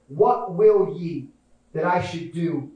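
low, slow, softening words; solemn question. Acted. Jesus asking you what he shall do, slow low solemnity. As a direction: ask it gently, with weighty pauses.

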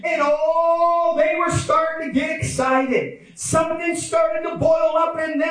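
high, swelling preacher delivery; fervent preaching. Real, not acted. Preacher telling of mounting excitement, high impassioned preaching. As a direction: let the excitement boil up.

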